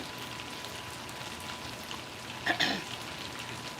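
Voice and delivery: choking cough after swallowing wrong; throat clearing. Real, not acted. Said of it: "I swallowed the wrong way".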